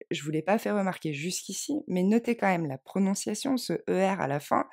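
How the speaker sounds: ordinary delivery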